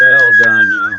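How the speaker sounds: shouted, high congratulations; loud praise. Real, not acted. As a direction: call it out to the room. Host calls out praise loudly.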